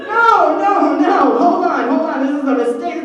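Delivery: loud, high, urgent pleading; panicked shout. Retold or imitated. Kaylee trying to stop the playground brawl, loud and panicked. Panicked kid stopping the fight.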